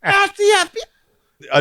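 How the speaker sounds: high, slow mock FBI yell; crazy kid yelling. Little girl pounding hotel doors, high slow yell. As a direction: bang and holler like a kid playing cop. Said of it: "starts banging on the door next".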